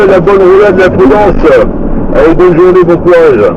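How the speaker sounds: ordinary delivery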